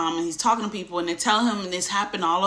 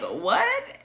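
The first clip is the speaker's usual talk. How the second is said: startled, baffled question; confused man suddenly waking up to the chaos; high confused what; 11 semitones higher than usual. Retold or imitated. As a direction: squeak it up high in bewilderment. Confused husband.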